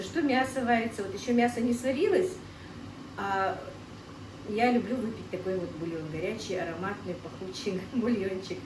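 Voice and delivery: normal talking voice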